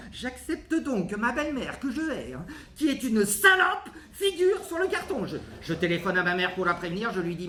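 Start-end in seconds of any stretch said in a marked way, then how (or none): 2.7-5.3 high-pitched, exasperated burst on the insult; furious outburst. Acted. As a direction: let hatred for the stepmother burst out high. Spits out the hated stepmother insult.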